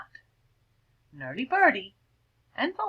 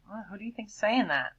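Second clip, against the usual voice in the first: ordinary delivery, nothing marked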